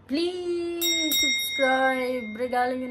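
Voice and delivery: high, drawn-out, loud plea; pleading. Acted. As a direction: stretch it out, begging sweetly. Begging viewers to subscribe. (malambing at pahabang pakiusap sa mga manonood)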